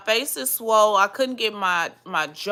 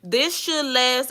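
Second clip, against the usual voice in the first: ordinary talk, nothing marked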